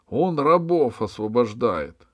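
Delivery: высокий громкий крик обвинения; медленно, с возмущением выкрикни обвинение; вопль. A narrator's reading. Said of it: "завопил маркиз". (возмущённый вопль)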